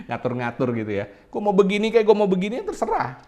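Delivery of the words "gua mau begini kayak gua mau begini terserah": defiant. Acted. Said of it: "defiant answer to the complainer".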